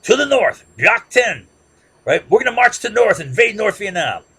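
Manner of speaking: high, loud, defiant vow to march north; a leader boasting of invading the north; South Vietnamese leader. Retold or imitated. South Vietnamese leader vowing loudly to invade the north. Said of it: "Khan saying".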